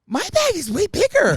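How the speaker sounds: a jolt of panic as the bag is searched; alarmed thought. Retold or imitated. Thinking at airport security, high and alarmed.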